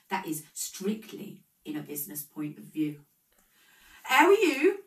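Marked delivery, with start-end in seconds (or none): 4.0-4.8 higher and louder, rising; screeching greeting. A narrator's reading. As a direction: call the greeting out high and loud. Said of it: "screeched Una".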